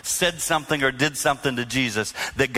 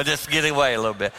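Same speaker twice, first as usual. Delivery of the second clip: ordinary talk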